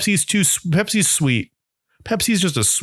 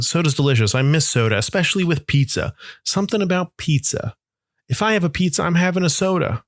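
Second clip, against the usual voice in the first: normal talking voice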